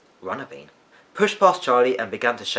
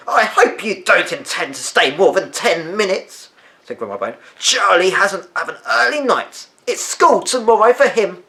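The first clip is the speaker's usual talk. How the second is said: put-on higher and louder, haughty old woman; stern grandmother; 9 semitones higher, 8 dB louder than usual. A narrator's reading. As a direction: lay down the rule coldly. Grandma Bone scolding Benjamin.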